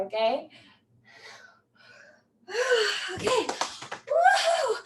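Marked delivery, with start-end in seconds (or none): 2.5-3.1 high sigh breaking into a laugh. Real, not acted. Sigh mid-squats. 4.1-4.8 high whooping woo; excited woo. Real, not acted. Pumping herself up.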